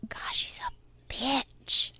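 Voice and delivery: slow catty whisper. Acted. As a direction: whisper it behind her back, slowly. Insult muttered behind her back.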